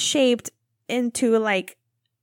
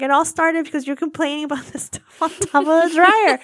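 laughing complaint; 3 semitones higher, 5 dB louder than usual. Retold or imitated. Laughing while retelling complaint to husband.

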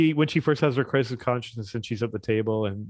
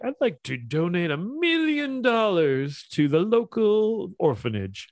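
Mister Burns quote; 6 semitones higher than usual. Retold or imitated. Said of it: "Mister Burns goes like".

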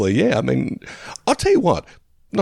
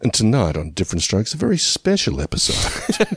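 mock network voice-over, stretched out; TV promo announcer. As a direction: announce it like a very special episode. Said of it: "Channel seven or Channel nine was announcing".

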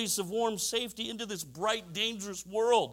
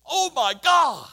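loud, drawn-out cry of pain; labor scream; 7 semitones higher, 12 dB louder, slower than usual. Retold or imitated. Wife in labor crying out to husband, loud pained shout. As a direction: belt it out like a woman mid-labor.

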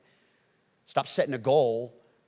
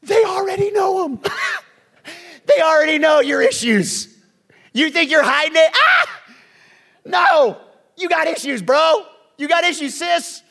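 loud, much higher shouted teasing; laughing shout; 12 dB louder than usual. Real, not acted. Pastor teasing the congregation about hidden issues, laughing shout. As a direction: yell it through laughter, teasing.

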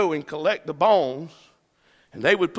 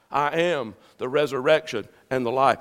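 low, softer voice for Jesus; Jesus's calm answer; 5 semitones lower, 5 dB quieter than usual. Acted. Jesus answers a grandparent's plea, low and measured. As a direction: drop down low and answer steadily.

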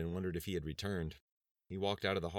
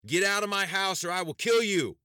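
screamed threat, much higher and louder; raised voice; 14 semitones higher, 10 dB louder than usual. A narrator's reading. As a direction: yell it with everything. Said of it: "He raised his voice".

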